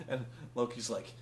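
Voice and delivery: ordinary talk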